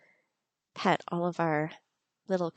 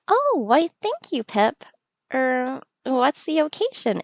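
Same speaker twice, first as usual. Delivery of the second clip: lightly voiced fond villager; Kara's voice; 4 semitones higher, 4 dB louder than usual. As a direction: voice Kara touched by the gift. Voicing Kara in game.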